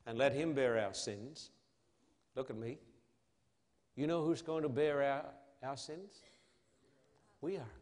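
usual speaking voice

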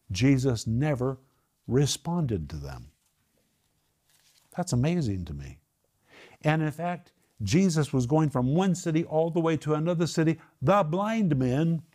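ordinary delivery

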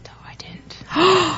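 gleeful unvoiced whisper. Real, not acted. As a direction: whisper it like a secret.

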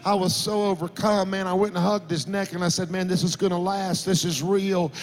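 retelling the milk crate conversion with rising passion; rising preaching. Acted. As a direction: preach it with growing fervour. Preacher builds the sermon story.

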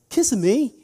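imitating a British tourist asking; British accent. Tourist repeating himself, in a mock British accent. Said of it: "He said".

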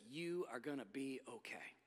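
almost hush it, like a promise; soft reassurance. Acted.

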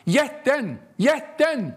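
put-on little girl crying out the solution to the villagers; girl shouting. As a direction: shout out the sudden idea. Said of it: "så skriker lillflickan".

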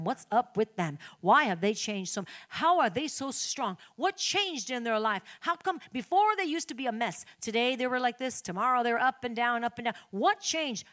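ordinary delivery

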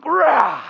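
loud, high burst of exasperation; short growly outburst of frustration; frustrated yell. Acted. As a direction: let out a sudden "rah" of irritation. Acting irritation at scratchy shirt.

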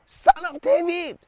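high, slow drawn-out cry for mercy; mock shout. Preacher voicing Bartimaeus calling to Jesus, high slow cry. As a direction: stretch the plea high like a blind beggar calling out. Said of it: "he shouted".

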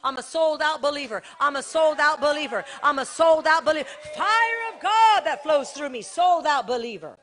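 loud, rising declaration; loud chanting preacher; full force preaching. Acted. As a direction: build it up with each repeat.

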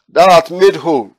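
high, loud, slow preaching warning; solemn warning. Acted. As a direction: proclaim it as a grave command.

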